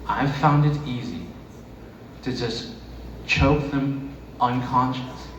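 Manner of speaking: ordinary talk, nothing marked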